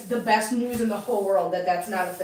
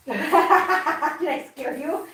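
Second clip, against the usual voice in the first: mock scary voice; 4 semitones higher, much slower than usual. Playful voice to kitten.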